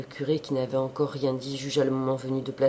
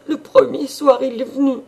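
much higher, louder stammer; stammering maid; 10 semitones higher, 9 dB louder than usual. A narrator's reading. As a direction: falter it out in shame.

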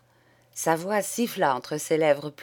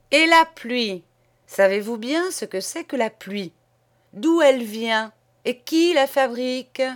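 put-on raised, emphatic sermon to children; preaching priest; 3 semitones higher, 4 dB louder than usual. Priest lecturing pupils about the rain, preaching fervently. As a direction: thunder at the little ones like a country priest.

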